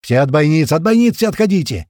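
loud command climbing in pitch; yelled order. A narrator's reading. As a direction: bellow at everyone to get back. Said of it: "И тут Туман снова орёт". (громкий тревожный приказ)

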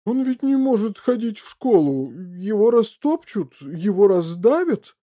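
high, loud, slowing into dread; alarmed fretting. A narrator's reading. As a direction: lament each danger, drawing it out. Father frets over his shrunken son.

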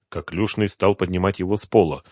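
ordinary delivery, nothing marked

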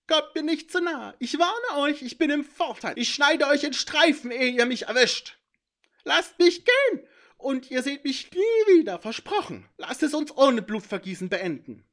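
high, loud, threatening creature voice; a cornered imp bargaining through threats; high tense warning. A narrator's reading. Cornered intruder warning the heroes off, high and loud.

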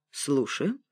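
plain reading voice

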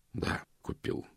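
soft, almost voiceless confession; sheepish near-whisper; guilty admission. A narrator's reading. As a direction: own up under the breath. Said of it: "виновато признался он".

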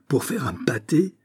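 a rising, offended echo of the words; indignant exclamation. Acted. The count echoes the magistrate, shocked and offended. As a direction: repeat it back in disbelief, pitch climbing. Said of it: "s'écria monsieur de G".

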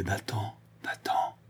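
a hoarse, fading whisper; dying father begs his daughter to go, whispering; terrified whisper. A narrator's reading. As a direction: breathe the last warning out unvoiced. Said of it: "d'une voix rauque et terrifiée".

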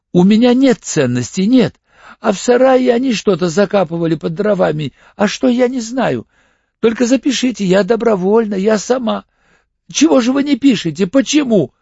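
very high, loud frantic pleading; hysterical. A narrator's reading. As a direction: protest in mounting hysterical panic. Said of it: "Тихо, без истерики". (истерично, взахлёб, на высоких нотах)

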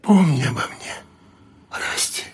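high soft voice fading to a whisper; ghostly farewell. A narrator's reading. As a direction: say goodbye as you vanish.

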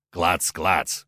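low, heavy golem clacks; a stone golem clanking out words; slow stone monotone. Acted. Golem answers with clacking sounds.

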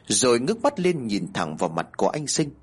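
usual speaking voice

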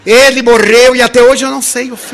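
laughing. Retold or imitated. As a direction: laugh as you tell it. Friend unable to finish, laughing.

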